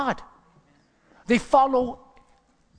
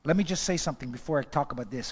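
usual speaking voice

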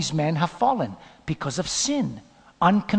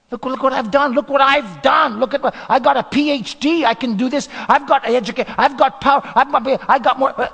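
boastful proud king; 8 semitones higher, 8 dB louder than usual. Acted. Proud king boasts about himself.

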